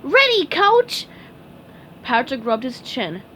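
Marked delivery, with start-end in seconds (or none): 0.0-1.1 high, slow eager shout. Acted. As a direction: report for duty eagerly. SpongeBob eager.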